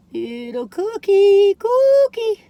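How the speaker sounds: high slow cooing; cute singsong. Real, not acted. Showing cookie earrings to viewers, high and cooing. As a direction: coo at the tiny cookie.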